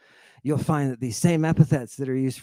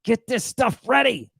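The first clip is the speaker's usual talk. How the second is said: mock yelling; 5 semitones higher, 3 dB louder than usual. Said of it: "He starts yelling at the servants".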